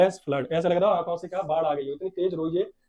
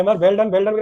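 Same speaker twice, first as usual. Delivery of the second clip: louder cheering-on; encouraging; 3 semitones higher, 9 dB louder, much slower than usual. Acted. Teacher to a stammering pupil, loudly encouraging. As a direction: urge the child along with more volume. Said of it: "Encouraged her".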